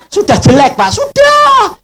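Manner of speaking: high, drawn-out comic complaint; retold exasperation. Preacher complains to police about old TV, drawn-out exasperation. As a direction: stretch out the last word, rising high.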